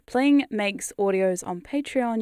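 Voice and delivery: ordinary talk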